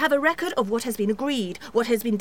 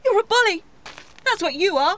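higher and louder, hurt outburst; angry accusation; 6 semitones higher, 4 dB louder than usual. Acted. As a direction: lash out at him as a bully.

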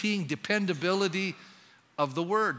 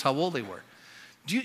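ordinary talk, nothing marked

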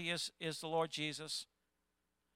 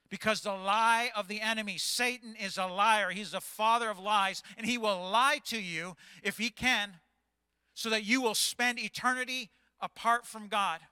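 preaching; 5 semitones higher, 5 dB louder than usual. Real, not acted. Preaching at full force.

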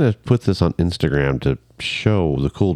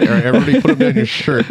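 raised pitch, breaking into laughter; laughing mock command; 13 semitones higher, 4 dB louder, faster than usual. Joking to friends about bricks of cash, laughing mock command. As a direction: act out a joke order through laughter.

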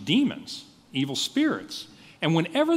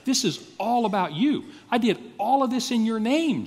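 higher pitch, punching "all" both times; emphatic; 7 semitones higher than usual. Retold or imitated. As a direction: insist hard on "all about you" and "all of this". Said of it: "they're just emphatic to say".

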